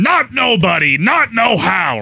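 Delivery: shouted, drawn-out gatekeeper refusal; gruff gatekeeper. Retold or imitated. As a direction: bellow it like the stubborn guard. Imitating the Oz gatekeeper.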